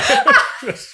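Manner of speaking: shrieking laugh at his own story; squealing laugh; high burst of laughter. Real, not acted. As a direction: let out a high, loud cackle. Big laugh after punchline.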